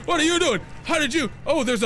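high, loud alarmed calls of hey; startled yelling. Real, not acted. Streamer startled by a monster popping up, high loud yell. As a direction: caught off guard and yelling at it.

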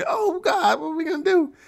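high mock panic; panicked girlfriend. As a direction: squeal it like a scared girlfriend. Girlfriend panics in movie scene.

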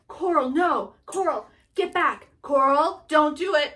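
loud pleading shouts; a frantic husband crying out after his wife; panicked yelling. Retold or imitated. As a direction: yell for her to stop. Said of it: "Marlin's like".

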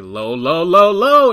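calls building to a loud, high yell; rising mock alarm shout; calling out urgently. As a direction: warn him as the branch comes at you. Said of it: "and I'm like".

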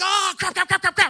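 loud, high panicked blurting; hammed-up alarm, high and loud; mock panic. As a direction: frantically try to take it back. Acting out regret at blurted words.

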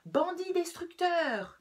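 high shouted insult from an angry rabbit; storybook rabbit bellowing at his neighbour; yelling. Acted. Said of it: "hurlait monsieur Brun".

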